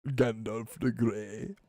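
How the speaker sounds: low, soft, slow wizard intoning; mock Gandalf. Naming Gandalf in a hushed wizard voice. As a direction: intone the name like an old wizard. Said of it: "That's how he talks".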